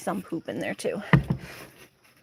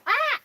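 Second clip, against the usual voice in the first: mock hen squawk. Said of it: "She's like".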